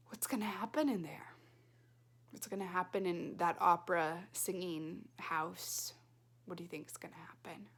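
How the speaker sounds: spooky hushed question; suspense whisper. Acted. Teacher whispering to the class to build suspense.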